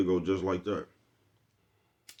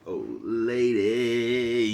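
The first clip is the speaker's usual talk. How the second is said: mock the nephew by dragging out old lady; drawn-out imitation; 3 semitones higher, much slower than usual. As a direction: stretch old lady out very slowly. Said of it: "Nephew says".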